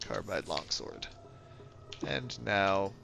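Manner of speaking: ordinary talk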